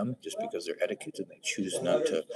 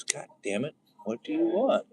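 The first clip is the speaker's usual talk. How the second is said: frustrated Noah; 9 semitones higher, 4 dB louder than usual. Retold or imitated. Noah's Notebook outburst again.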